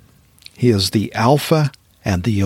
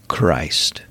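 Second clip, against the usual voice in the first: one hushed, falling breath; awed whisper to close the list; reverent whisper; 6 semitones lower, 3 dB quieter than usual. Acted. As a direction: whisper the last title in awe. Final title of the recap.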